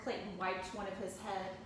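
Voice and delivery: plain reading voice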